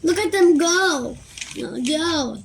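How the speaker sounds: bright delighted dad; excited. Acted. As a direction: cheer the ants on. Dad watching ants excitedly.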